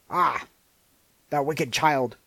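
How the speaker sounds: the enchantress cries out loud in anger; angry cry. Acted. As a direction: scold the wicked child loudly. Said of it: "cried the enchantress".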